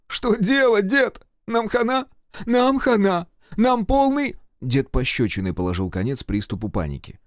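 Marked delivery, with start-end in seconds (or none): 0.1-4.4 high, loud panicked cries; terrified yelling. A narrator's reading. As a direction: yell in rising panic at Grandpa. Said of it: "в ужасе заорал". (орать в ужасе)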